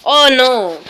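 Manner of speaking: a real gamer's loud protest; dismayed cry. Real, not acted. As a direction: cry out as it goes wrong. Mishap in the game.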